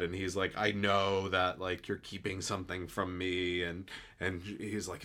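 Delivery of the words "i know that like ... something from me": ordinary talk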